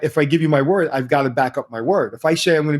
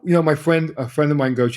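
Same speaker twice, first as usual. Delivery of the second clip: ordinary talk, nothing marked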